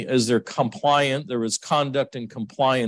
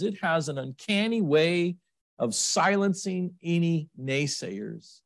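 ordinary delivery

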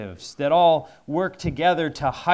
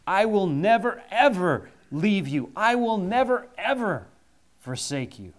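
"never" and "ever" pitched higher and louder each time, "forsake you" dropping down; emphatic stress; 5 semitones higher, 3 dB louder than usual. Shown as a demonstration. Preacher renders the five Greek negatives for the congregation, hammering "never, ever". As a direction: build the double "never, ever" like an unbreakable vow. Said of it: "So we might translate the promise".

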